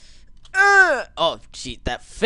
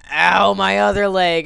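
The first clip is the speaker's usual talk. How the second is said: mock pain; 4 semitones higher, 9 dB louder than usual. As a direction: overplay the injury. Character loses other leg.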